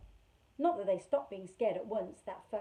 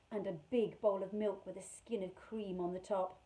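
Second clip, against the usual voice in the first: ordinary delivery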